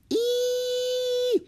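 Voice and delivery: very high, thin squeal; high-pitched dragon cry. A narrator's reading. Baby dragon caught in a bubble, high squeal. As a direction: shriek like a trapped baby dragon. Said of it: "He let out a high-pitched cry".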